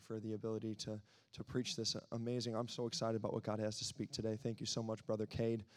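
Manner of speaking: ordinary talk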